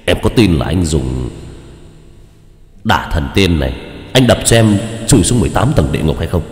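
a lightly voiced icy threat; giọng Đạo Phong. Shown as a demonstration. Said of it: "giọng nói nghe rất là lạnh lùng". (giọng lạnh lùng, đe dọa, to dần)

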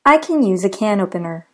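first "can" weak like "Ken", "can opener" full; reduced can vs full can. Shown as a demonstration. Teacher showing two ways to say can. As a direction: contrast the two cans. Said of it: "I'll repeat this example".

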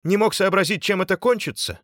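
high, loud, strained reproach; outraged, high-pitched question; angry scolding. A narrator's reading. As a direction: berate the culprit. Subbotin berates the culprit.

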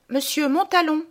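high-pitched formal announcement, falling at the end; maid announcing. Acted. Maid announces a visitor to the household, high formal call. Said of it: "voilà que la bonne annonce".

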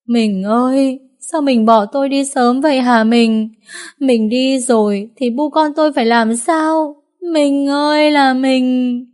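high, keening lament, slowing into long drawn cries; a widow wailing over her husband's coffin; mournful wailing. A narrator's reading. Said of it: "Tiếng khóc ái oán của bu con cô".

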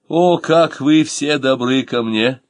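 plain reading voice

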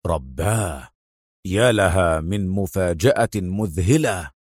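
low, slow, drawn-out astonishment; stunned exclamation. A narrator's reading. Adham reads the address and jumps up, slowly stunned. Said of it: "يهب واقفا وهو يهتف".

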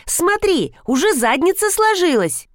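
put-on very high, excited little-girl voice; child's yell. A narrator's reading. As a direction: cry out high like a delighted child. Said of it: "завопила киса". (звонкий детский крик)

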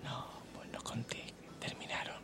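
whispered gossip; whispering. Real, not acted. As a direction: whisper it so nobody overhears. Gossip about a couple breaking up.

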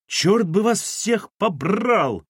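high, slow yelled curse; yelling curse. A narrator's reading. Wife yelling at the family, high and drawn out. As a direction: bellow it from another room. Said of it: "завопила откуда-то Кира".

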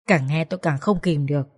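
ordinary delivery

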